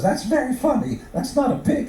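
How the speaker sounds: high, dry wife retort; mock-sarcastic high voice. Wife scoffing at her husband, high and sarcastic.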